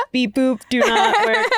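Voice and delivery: high, slow malfunctioning robot, laughing through it. Pretend. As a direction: play a broken machine. Calls herself broken like machine.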